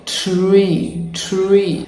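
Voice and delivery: "tree" drawn out with a loose tongue; American TR. Shown as a demonstration. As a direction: let the tr in "tree" flow. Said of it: "let your tongue go".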